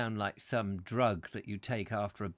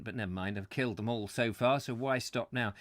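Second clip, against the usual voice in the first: ordinary delivery, nothing marked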